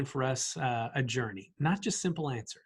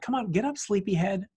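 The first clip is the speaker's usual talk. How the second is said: higher, playful, tender wake-up call; waking a child; 5 semitones higher than usual. Retold or imitated. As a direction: coax a sleepy child out of a nap. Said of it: "what you would say to your child".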